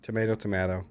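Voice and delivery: "tomato" as to-MAY-to, then with the vowel changed to to-MA-to; imitated American pronunciation. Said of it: "But American would say".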